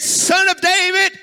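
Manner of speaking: high, loud plea; crying out. Retold or imitated. Beggar cries out to Jesus, high and loud. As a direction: cry it out like a blind beggar calling. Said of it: "He said".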